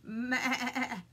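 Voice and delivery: stuttering goat imitation; goat's bleat. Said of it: "said Goat".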